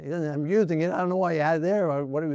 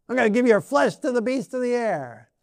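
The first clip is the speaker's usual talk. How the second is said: higher-pitched acted giant's threat; lightly acted put-down; mock taunting threat; 7 semitones higher, 4 dB louder than usual. Acting Goliath putting David down.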